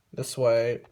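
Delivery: normal talking voice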